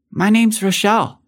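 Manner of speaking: put-on high, sweet small child voice; sweet little girl. A narrator's reading. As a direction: speak like a shy little girl. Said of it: "she whispered sweetly".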